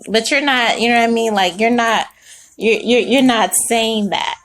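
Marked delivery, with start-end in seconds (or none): none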